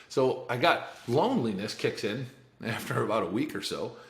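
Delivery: normal talking voice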